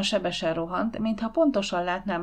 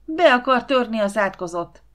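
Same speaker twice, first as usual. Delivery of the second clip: loud, higher, agitated yell; shouted accusation; 4 semitones higher, 7 dB louder than usual. A narrator's reading. Ákos accusing the captured intruder, yelling. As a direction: keep shouting, raised in pitch.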